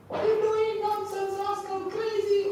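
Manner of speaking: imitated high-pitched hysterical yelling; mother screaming. Mother cursing the fireman who throws children out, mock screaming. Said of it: "mother screaming".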